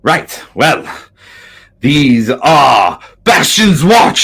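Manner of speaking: loud, slow proclamation; grand introduction. Acted. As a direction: announce them slowly and loudly. NPC introduces the party.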